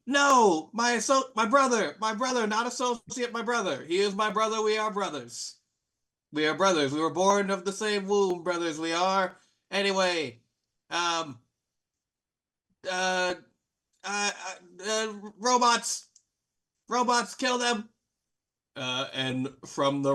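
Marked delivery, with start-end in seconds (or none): 0.1-9.4 high-pitched, hammed-up protest over a brother; distraught villain. Game master voicing Slim as Pim cries out, hammed-up distress. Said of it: "Slim goes". 9.7-17.9 high, halting villain voice; stammer, then give a high-pitched order; flustered villain. Acted. Game master's Slim ordering robots to attack, flustered. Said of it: "Slim goes".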